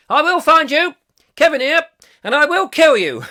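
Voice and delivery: high, loud mock caller voice; comically loud, high-pitched funny old bloke; Kevin Hall. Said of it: "I want Kevin Hall to play".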